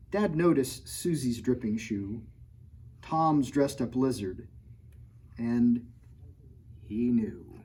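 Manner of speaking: lively picture-book read-aloud; reading to children. A narrator's reading. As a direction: read the rhymes to a kid audience. Reads rhyming picture book.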